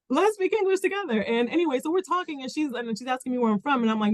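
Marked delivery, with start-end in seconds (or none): 0.1-1.2 mock eager. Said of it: "so I'm like".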